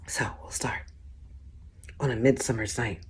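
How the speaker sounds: unvoiced hushed whisper. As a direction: lean in and whisper. Whispered aside before the sonnet.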